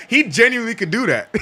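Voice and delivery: quick, high, laughing delivery; amused rush of words breaking into laughter. Real, not acted. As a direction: talk through a real laugh. Laughing at his own suggestion.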